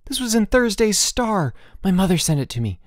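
high-pitched urgent explanation. A narrator's reading. Boots showing George the newspaper article, urgent. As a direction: tell it high and eagerly.

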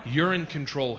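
ordinary delivery

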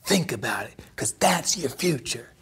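unvoiced ominous whisper. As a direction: breathe the warning softly. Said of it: "He said".

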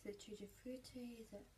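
whispered guess; whispering. Real, not acted. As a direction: whisper it to yourself. Whispers while tasting.

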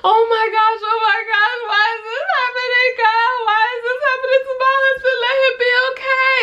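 very high, loud mock wail; crying re-enactment. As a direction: wail and plead as if bawling. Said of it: "I immediately start crying".

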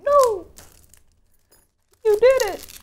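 sudden high excited shout; excited yell. Real, not acted. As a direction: burst out in delighted surprise. Opening deck with keys, excited cry.

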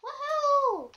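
high whooping cheer; excited cheer. Real, not acted. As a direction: whoop with joy.

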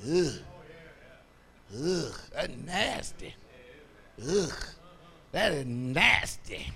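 hushed, slow imitation of a grossed-out kid; disgusted child. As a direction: play the children recoiling, soft and low. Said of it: "they do like this".

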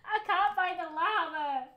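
playful whiny fake sob, slowed; mock crying. Can't find lava in time, mock crying whine. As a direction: whine it like a pretend cry. Frustrated search.

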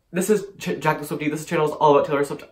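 ordinary talk, nothing marked

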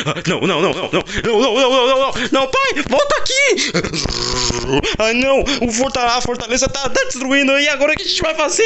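panicked cry. Acted. Ninja shrieking to dad as the fortress falls, panicked. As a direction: panic as the fortress crumbles, pitch climbing.

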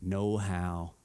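hushed, slow no way; doubting. Retold or imitated. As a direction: mutter the doubt to yourself. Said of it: "you're gonna think".

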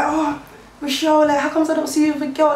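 high mocking voice; imitating nosy people. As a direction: mimic what people keep asking. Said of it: "people's like".

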